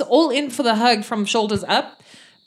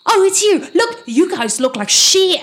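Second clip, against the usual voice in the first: guard; 9 semitones higher, 5 dB louder than usual. Acted. Guard leans out, greets party.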